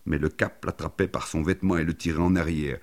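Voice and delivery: plain reading voice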